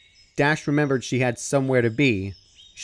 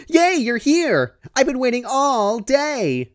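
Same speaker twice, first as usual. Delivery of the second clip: Pinkie's high, bouncy, thrilled squeal; bouncing excitement; 12 semitones higher, 5 dB louder, slower than usual. Acted. Pinkie greets Rainbow Dash at the store, high and thrilled. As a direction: squeal it like a pony who can't keep still. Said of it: "who was bouncing in excitement".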